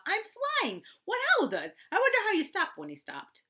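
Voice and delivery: a bear surprised to find himself flying; excited falling cry. Acted. Pooh falling into a pit.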